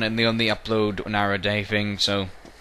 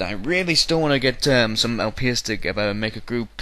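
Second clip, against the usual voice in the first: ordinary talk, nothing marked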